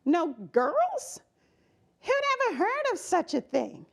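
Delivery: put-on high, indignant little girl voice; a sassy kid fuming at the news; mock outraged little girl; stewing girl. A narrator's reading. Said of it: "she stewed".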